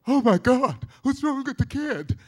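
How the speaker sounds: loud, high mock panic; panicked parent. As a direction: cry out like a frantic parent. Parent panicking at crying baby.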